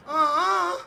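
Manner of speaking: put-on high, loud refusal as a wary kid; acted childish refusal, high and loud; reluctant kid. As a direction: pitch way up for a balking boy. Friend refuses the risky dare.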